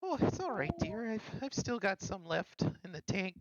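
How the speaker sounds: high, soothing mom voice calling someone dear; lightly voiced fussing mom; motherly reassurance. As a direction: comfort the child like a doting mother. Cleric plays a mother healing.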